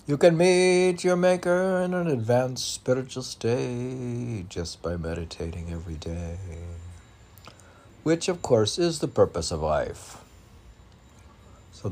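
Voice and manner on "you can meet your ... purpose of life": rhythmic, lifted poetry reading; poem recitation. Acted. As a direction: declaim each line like a spiritual verse. Reads a poem aloud.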